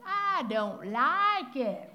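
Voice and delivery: slow, drawn-out mock pout; stubborn refusal. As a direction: sulk it out slowly like a defiant child. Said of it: "that's what we're like with God".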